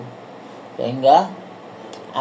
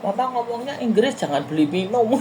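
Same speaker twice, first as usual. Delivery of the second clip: higher, mock child's nagging voice; child scolding mum; 8 semitones higher than usual. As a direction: play the daughter teasing her mother. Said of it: "Anakku gini". (menirukan suara anak yang menegur ibunya)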